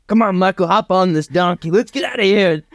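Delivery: high cowboy call to hurry; urging cowboy father. Acted. Cowboy father getting Michael onto a donkey, high urging call.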